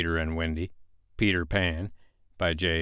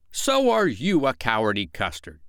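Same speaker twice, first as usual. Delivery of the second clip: emphatic start, falling petulant retort; childish comeback; 3 semitones higher than usual. A narrator's reading. Father returning Michael's taunt, petulantly.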